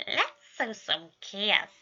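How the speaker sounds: slow, relished mischievous line; slow gleeful mischief; scheming. A narrator's reading. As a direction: savour every word of the plan.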